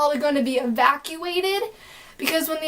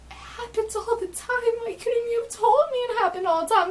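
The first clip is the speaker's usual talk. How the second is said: high, squeaky exasperated disbelief; mock freak-out; 8 semitones higher than usual. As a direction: act out an indignant, freaked-out protest. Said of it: "I'm sitting there freaking out".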